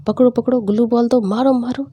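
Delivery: high, loud excited shouting mid game; imitating brother yelling at his game; mock gamer shout. Brother yelling at his teammates mid game, excited shout.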